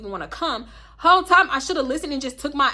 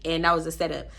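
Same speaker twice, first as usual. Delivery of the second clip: ordinary talk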